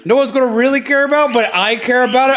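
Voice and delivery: bubbling over about a new toy; excited. Real, not acted. Streamer gushing to viewers about something only she cares about, excited. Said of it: "I'm very excited about it".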